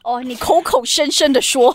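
hammed-up Channel Eight villainess jab; mock drama accusation. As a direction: throw it out like a TV drama catchphrase. Quoting a famous drama line.

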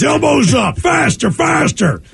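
high-pitched, urging coach call; workout instructor. Acted. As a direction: push them on like an aerobics coach. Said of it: "Staring critiques with".

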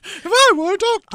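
high, laughing doctor impression. Shown as a demonstration. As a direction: play a doctor while laughing. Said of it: "is that your doctor voice?".

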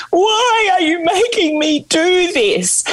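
high, slow, tearful cry; sobbing plea. Retold or imitated. Bride sobbing to her new husband at the airport, high and tearful. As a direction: plead through tears, voice breaking high. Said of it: "I'm sobbing".

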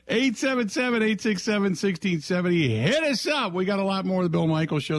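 bright, high, fast radio announcer; announcer read. Acted. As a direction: punch the call-in number like a DJ. Radio host promo read.

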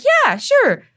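chirpy high imitation of a cheerful receptionist; front desk lady. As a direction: toss it off like it's no big deal.

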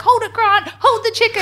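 hammed-up, high-pitched order; mock frantic command. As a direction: imitate a mum urgently ordering her husband. Imitates mum ordering dad about.

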